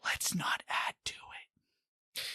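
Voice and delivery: sudden hushed whisper; pointed, voiceless whisper; whispered plea. Real, not acted. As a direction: drop to a whisper to end the rant. Rant drops to a whisper.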